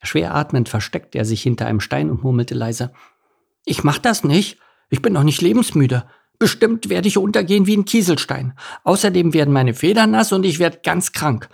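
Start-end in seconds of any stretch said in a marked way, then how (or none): 3.7-11.5 high-pitched worried muttering to himself; anxious little duckling complaining, pitched high; timid duckling, fretting. Acted. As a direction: reel off fears in a high child's voice. Enno frets behind a stone.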